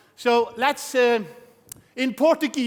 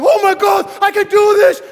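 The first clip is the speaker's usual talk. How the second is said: much higher and louder, amazed; thrilled recruit; 10 semitones higher, 11 dB louder than usual. Retold or imitated. Recruit fresh from a meeting, thrilled shout. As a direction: gasp out sudden confidence. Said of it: "you're like".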